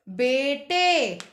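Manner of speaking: बेटे very slow, no nasal at the end; slow plain plural. Shown as a demonstration. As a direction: stretch बेटे to show no bindi. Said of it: "हमें बिंदी नहीं सुनाई दे रही।". (बेटे धीरे, बिना अनुनासिक)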